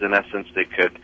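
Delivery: normal talking voice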